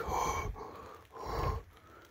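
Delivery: long breathy bear huffs; imitated heavy breath. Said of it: "he was, had a heavy breath".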